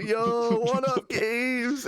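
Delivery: high, long-held mock holler; retold hype call. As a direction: call back in a drawn-out, hammed-up greeting. Retold call back to game guys.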